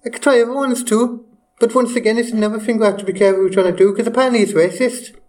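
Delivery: high put-on Liverpool accent, sounds Welsh. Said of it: "People from Liverpool, right?".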